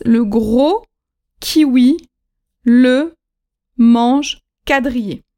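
slow mange quadrillé, spelled out carefully; teacher voice, slow and deliberate; slow and clear. Shown as a demonstration. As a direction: draw out mange quadrillé for learners. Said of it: "mnemonic said slowly".

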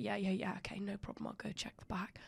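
whispered, breathy quote of the shop worker; hushed worker. Retold or imitated. Imitating the store worker whispering to shoppers. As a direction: mimic the stock boy's quiet mumble.

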